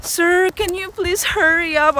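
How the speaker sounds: high, pleading near-tearful whine; high whining plea to the bank agent; whiny plea. Retold or imitated. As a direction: beg him to hurry, almost crying. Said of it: "I really speak like that".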